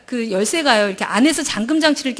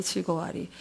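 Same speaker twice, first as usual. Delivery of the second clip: "즐거워하리" repeated much softer and lower, fading almost away; fading recitation; 6 semitones lower, 11 dB quieter than usual. Retold or imitated. Reciting the verse to God, her repeated "즐거워하리" fading almost away. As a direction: let "즐거워하리" die out to near silence.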